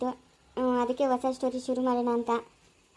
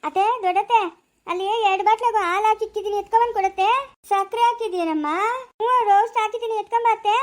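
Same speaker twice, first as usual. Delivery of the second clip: raised, higher voice calling to an aunt; 5 semitones higher, 4 dB louder than usual. Acted. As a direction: call across the house over crying babies. Calling aunt to fetch bottles.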